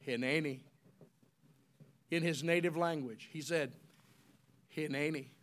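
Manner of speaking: low, soft reply; quiet answer. Acted. Isaiah answering God softly, low and quiet. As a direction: answer humbly, barely above a whisper.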